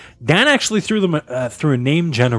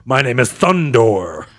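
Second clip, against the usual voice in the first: supervillain announcement; 5 semitones lower, slower than usual. Acted.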